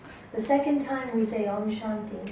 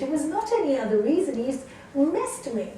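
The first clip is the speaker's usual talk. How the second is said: ordinary delivery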